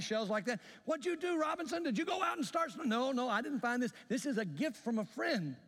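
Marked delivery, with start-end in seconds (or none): 0.8-2.9 high mocking falsetto ribbing, quicker on the last question; teasing friends. Friends teasing him about shells. 2.9-5.6 proud correction. Retold or imitated. Proudly correcting his friends.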